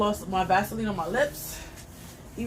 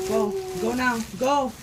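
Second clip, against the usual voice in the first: normal talking voice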